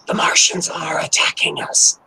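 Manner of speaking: hushed conspiratorial whisper. Pretend. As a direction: whisper it like a secret alarm.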